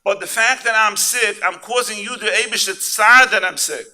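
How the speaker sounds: loud, high fervent sermon voice; preaching. Acted. Rabbi preaching to the congregation, fervent and loud. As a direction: drive it home to the crowd.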